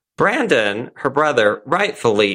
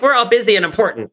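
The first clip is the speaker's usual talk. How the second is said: mock self-important bankers; 9 semitones higher, much faster than usual. Mocking busy businessmen.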